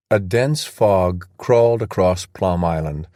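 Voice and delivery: plain reading voice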